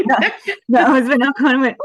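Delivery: high laughing voice. Real, not acted. Student recalling sudden success, laughing. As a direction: laugh through the words.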